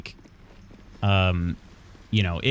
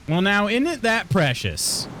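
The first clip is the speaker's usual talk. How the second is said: much higher, slow doting coo; gushing sweet voice; 8 semitones higher, slower than usual. Pretend. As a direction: dote on it like a grandma. Gushing over cute character.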